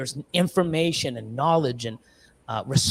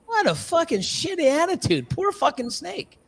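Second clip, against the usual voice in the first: mock disgust; 11 semitones higher than usual. Real, not acted. Disgusted comment, high voice.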